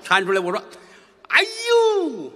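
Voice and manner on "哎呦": high loud cry of 哎呦; loud high dismayed 哎呦; sighing exclamation. Acted. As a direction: wail 哎呦 like a sigh of dismay. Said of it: "这叹气".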